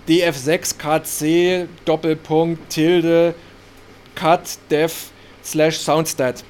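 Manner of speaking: slow, letter by letter dictation. Shown as a demonstration. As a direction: spell it out slowly, each item apart. Reads cryptic notepad entries aloud.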